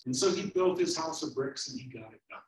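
ordinary talk, nothing marked

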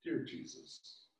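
whispered, soft and tearful; soft whispered plea; whispered prayer. Acted. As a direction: whisper to Jesus, nearly crying.

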